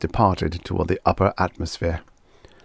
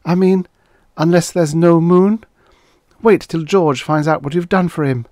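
put-on high-pitched, louder young woman; girl's voice; 10 semitones higher, 5 dB louder than usual. A narrator's reading. Karen teases Guthrie about George, in a high girl's voice. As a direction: voice her lines well above the narration.

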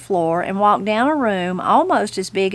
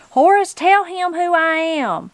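high-pitched, insistent granny; indignant appeal; 8 semitones higher than usual. Acted. Granny appeals to the judge while held, indignant appeal. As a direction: demand to be vouched for, voice pitched high.

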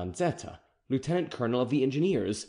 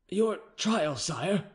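higher, hesitant captain voice; lightly voiced anxious officer; nervous captain; 7 semitones higher, much slower than usual. A narrator's reading. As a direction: break the bad news reluctantly. Captain announcing the trial.

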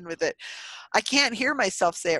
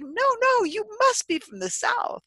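high-pitched imitation of insistent strangers; lightly hammed-up insistent voice; insisting, mock high voice. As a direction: mimic them insisting, pitched up. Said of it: "And they're like".

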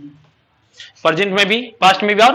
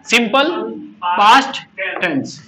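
slow drawn-out demo; slower than usual. Shown as a demonstration. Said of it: "past का बोल के सुनाओ".

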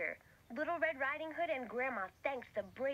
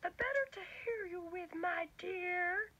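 high sly granny voice; wolf in disguise answering, high and sly; wolf as grandma; 6 semitones higher, slower than usual. Acted.

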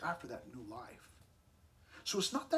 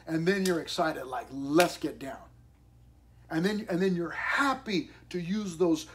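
loud, fired-up sermon about new cleats; preaching at full force; 3 semitones higher, 9 dB louder than usual. Acted. Pastor rousing the church, loud and excited. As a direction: drive it home to the congregation.

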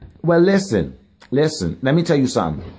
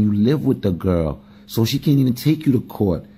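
normal talking voice

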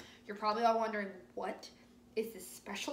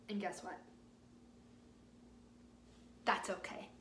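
ordinary delivery, nothing marked

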